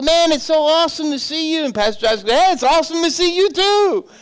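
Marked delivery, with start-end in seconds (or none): 0.0-1.6 high-pitched gushing hello; imitated overjoyed greeting; effusive greeting. 2.3-4.1 high, gushing mock delight; match the stranger's big hello; put-on overjoyed reply; effusive greeting back. Pastor returns greeting, feigning familiarity.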